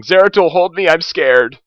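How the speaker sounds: high, loud hammed-up scaredy-cat cry; mock panicked yell. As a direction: yell for protection in exaggerated panic. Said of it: "jumps into the room yelling".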